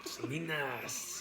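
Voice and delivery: "Selena's" drawn out slowly with a final S sound; S, not Z. Retold or imitated. Mimicking the film's brother, "[name]" with long final S. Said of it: "He said it with an S".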